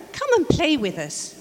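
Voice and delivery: bright, high invitation to play; playful call. Acted. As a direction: coax a friend to come play. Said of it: "they'd call".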